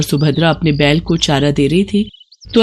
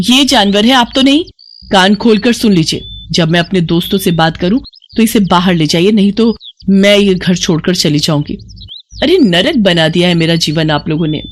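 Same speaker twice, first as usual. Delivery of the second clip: angry ultimatum. Acted. Bride threatening to leave home, angry.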